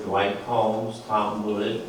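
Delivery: normal talking voice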